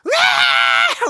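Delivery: put-on loud, high, long drawn-out baby cry; newborn wail. Imitating a newborn's first cry, loud high wail.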